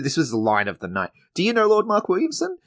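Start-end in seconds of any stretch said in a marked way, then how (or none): 1.3-2.6 high, far-off mimic of Justine; imitating the coworker's high voice; Justine imitation. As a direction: mimic her bright nervous question. Voicing Justine's line.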